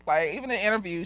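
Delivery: ordinary talk, nothing marked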